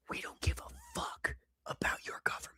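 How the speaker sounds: very soft, whispered dismissal; hushed, barely voiced; emphatic whisper. Real, not acted. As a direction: drop to a whisper to make it very clear. Spelling it out to foreigners.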